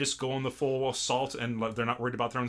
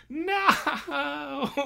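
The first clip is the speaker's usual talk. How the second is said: high hammed-up no; high cartoon wail; mock wail; 12 semitones higher than usual. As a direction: despair like a cartoon. Reacting in mock despair.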